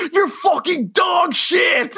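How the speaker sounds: high, slow yelled insult; hammed-up high yell; mock screaming insult. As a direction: scream it at the cops. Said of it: "starts screaming at the cops".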